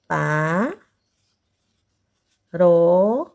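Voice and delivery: PAROXÍTONA in long, separate syllables. Shown as a demonstration. Teacher dictating as the class writes, syllable by syllable. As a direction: say PAROXÍTONA very slowly, pausing after each syllable. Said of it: "Então, vamos escrever?".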